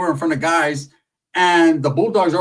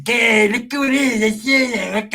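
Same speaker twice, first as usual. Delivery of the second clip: high Pepe voice; 6 semitones higher, slower than usual. Retold or imitated. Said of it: "And, and Pepe comes in".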